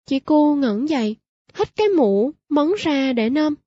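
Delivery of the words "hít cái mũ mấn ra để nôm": usual speaking voice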